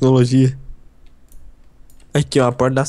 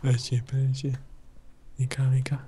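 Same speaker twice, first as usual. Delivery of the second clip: whispering; 10 dB quieter than usual. Real, not acted. Hiding from monster.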